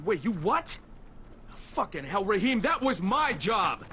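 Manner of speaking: put-on can't believe the kid went without him; shocked anger. Crane finds out Rahim went ahead, outraged.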